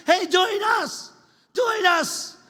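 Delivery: high, loud drawn-out calls; shouting. Acted. Soul winners call others to join them, shouting. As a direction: holler the invitation, then repeat it. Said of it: "soul winning ministries shouting".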